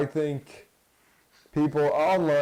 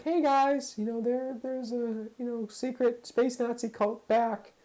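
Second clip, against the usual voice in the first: mock Cara Dune; 11 semitones higher than usual. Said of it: "Cara Dune would probably be like".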